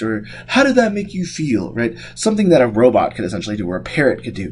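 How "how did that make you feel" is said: mock therapist. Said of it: "the joke about, you know, psychiatrists".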